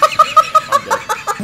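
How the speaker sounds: high burst of laughter. Real, not acted. Laughs at game chaos.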